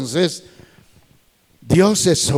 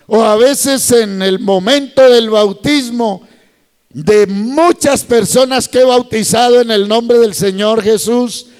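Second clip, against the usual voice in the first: higher, louder preacher's proclamation; fervent preaching; 7 semitones higher, 5 dB louder than usual. Acted. Pastor telling the brethren about baptisms, raised preaching voice. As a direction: preach it to the congregation with fire.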